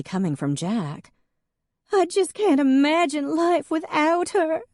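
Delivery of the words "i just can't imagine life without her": high, louder, tearful wail; weeping Hadley. Acted. Hadley to Jack about her dead cat, weeping high. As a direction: wail it like the world has ended. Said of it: "Hadley wept".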